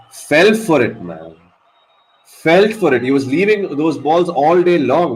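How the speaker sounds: real exasperated fan; frustrated outburst. Real, not acted. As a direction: blurt it out, annoyed the batsman fell for it.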